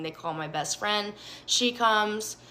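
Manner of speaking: ordinary talk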